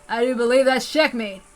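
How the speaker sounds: a lightly voiced sly, confident old cop; lightly voiced smug Oishi; smug detective. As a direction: savour the win. Oishi triumphs over gunmen.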